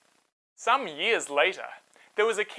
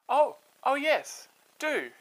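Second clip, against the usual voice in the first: higher, slow eager yes; delighted princess; 8 semitones higher, slower than usual. Acted. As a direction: happily accept the offer.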